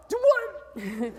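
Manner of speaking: high, baffled laughing reply; mock bewilderment; confused rider. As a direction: squeak out the question in confusion. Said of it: "rider's confused reply".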